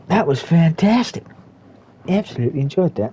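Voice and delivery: excited race commentary. Acted. Race caster to viewers, calling the finish excitedly. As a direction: call the cars home with building excitement.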